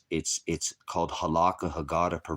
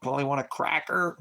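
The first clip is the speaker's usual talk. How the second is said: "cracker" a bit higher, light parrot squawk; hint at a parrot asking for a "cracker"; mild parrot voice; 9 semitones higher than usual. Pretend. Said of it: "mirroring like a parrot".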